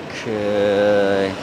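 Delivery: ordinary talk, nothing marked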